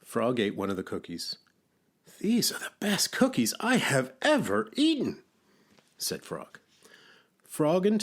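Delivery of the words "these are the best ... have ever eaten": very high, enthusiastic character voice; delighted praise. A narrator's reading. Frog raves about Toad's cookies, high delighted voice. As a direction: gush in a high creature voice.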